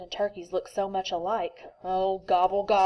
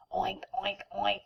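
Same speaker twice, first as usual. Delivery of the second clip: snorted oinks; 7 dB quieter than usual. Acted. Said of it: "snorted Pig".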